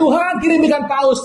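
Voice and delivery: playful imitation of a desperate plea; mock cry. Believer in trouble crying to God, mock high plea. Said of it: "Anda teriak".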